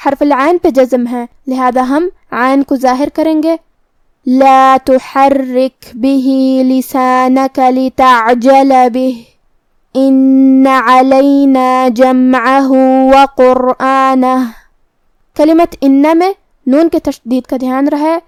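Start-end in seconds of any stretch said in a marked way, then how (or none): 4.3-14.3 slow, measured tajweed chant; Quran recitation. Shown as a demonstration. Teacher recites the verses slowly for students. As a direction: recite slowly, each letter clear. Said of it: "recites verses in tajweed".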